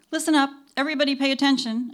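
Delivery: loud, high, quick call to the crew; rally everyone briskly with confidence; affable, confident announcement. A narrator's reading. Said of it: "announced the assistant director affably and confidently".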